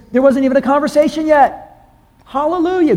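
high, ringing praise; preacher rejoicing at answered prayer; excited hallelujah. Real, not acted. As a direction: lift your voice in joy. Preacher exults over answered prayer.

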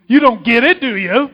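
loud, imagined comeback from the cross; exasperated retort. Acted. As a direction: fire it back at the mockers, loudly. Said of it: "I might have said".

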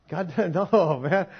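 normal talking voice

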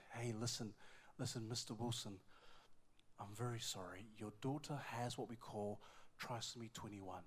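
low, soft doctor's voice; breaking bad news. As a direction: imitate a doctor gently delivering bad news.

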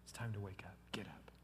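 hushed and tender; gentle whisper. Acted. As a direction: whisper "Little girl" softly. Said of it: "Jesus is in no hurry".

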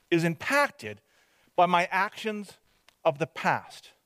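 usual speaking voice

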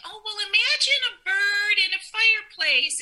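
high, dismissive mock tone. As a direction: parody a glib counsellor. Mocking someone's pretend advice.

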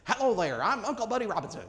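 a hint of a tape played at the wrong speed; Uncle Buddy Robinson's voice. Preacher imitating an old recording of Uncle Buddy Robinson. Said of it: "They recorded it the wrong speed".

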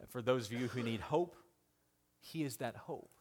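usual speaking voice

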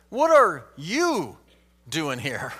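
slow question with a soaring YOU; stress on YOU. Shown as a demonstration. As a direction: lean long and hard on "you". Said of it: "consider this question by emphasizing different words".

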